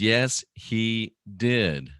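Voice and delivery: YES, HE DID drawn out slowly, word by word; most emphatic. Retold or imitated. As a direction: read "Yes, he did" as slow, separate, emphatic words. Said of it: "most emphatically, triple exclamation point says".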